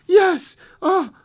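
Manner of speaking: high, loud broken cry; anguished cry. Acted. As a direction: cry out in grief. Father's shocked cry.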